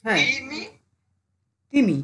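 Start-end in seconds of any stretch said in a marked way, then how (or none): 1.7-2.0 quick, unbroken তিমি; said fast. Shown as a demonstration. Teacher showing students that syllables hide, said fast. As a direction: say তিমি at speed in one go. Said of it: "স্পিডে উচ্চারণ করলে".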